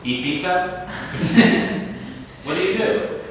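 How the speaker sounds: teasing question. Acted. Joking quiz to students.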